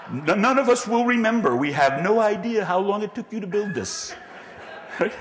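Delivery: can barely get it out for laughing; real laughter while joking about forgotten project teams. Real, not acted. Laughing at his own joke.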